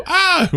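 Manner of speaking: high mock cry on being punched; imitated cry. Retelling her stumble through the window, imitated high cry. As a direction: mimic a short, high yelp of falling backward.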